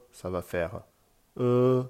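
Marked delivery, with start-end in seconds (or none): 1.4-1.8 E held high and level, loud; "E" on one raised, steady pitch; first tone. Shown as a demonstration. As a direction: sustain a flat, high "E". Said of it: "Avec le son E".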